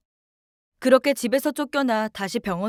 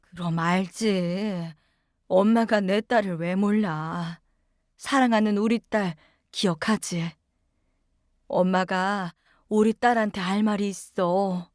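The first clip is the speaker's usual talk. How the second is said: weak sick mother; 3 semitones lower, slower than usual. Acted. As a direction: speak gently, barely awake. Sick mother just woke from coma. (혼수에서 깨어난 엄마, 느리고 힘없는 다정한 목소리)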